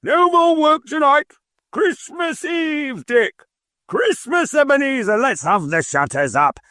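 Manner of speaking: a fat, jovial boss hollering for his apprentices. A narrator's reading. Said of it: "cried old Fezziwig".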